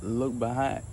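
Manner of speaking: soft, gentle voice of Jesus; hushed, loving call; small still voice. Shown as a demonstration. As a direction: speak it softly and peacefully, full of love. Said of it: "let me try".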